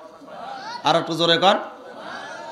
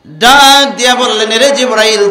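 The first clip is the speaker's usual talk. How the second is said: loud, higher proclaiming; booming call; 5 semitones higher, 7 dB louder than usual. Acted. God calling Jibrail, loud and high. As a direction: call out with authority.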